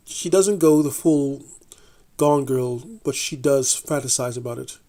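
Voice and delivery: ordinary delivery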